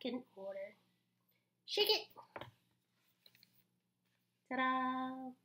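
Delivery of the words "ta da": ordinary talk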